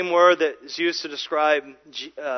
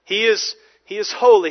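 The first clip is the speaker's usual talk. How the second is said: ordinary delivery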